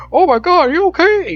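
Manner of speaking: very high, loud mock panic; alarmed bystanders. Imitating people fussing over a man who jumped out, mock alarm. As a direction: squeal it like a worried onlooker, hammed-up. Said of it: "these people are like".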